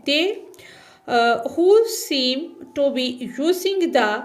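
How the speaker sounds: reading the English line aloud, x0.4 word by word; slow textbook reading. Shown as a demonstration. Teacher reading the textbook line slowly to students. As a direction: read each word slowly for the class.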